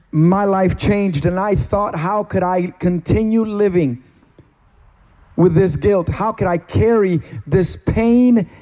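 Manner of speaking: ordinary delivery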